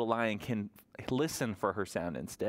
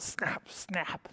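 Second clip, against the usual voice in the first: whispered snap; 5 semitones higher, 3 dB quieter than usual. Pretend. Crocodile verse ending.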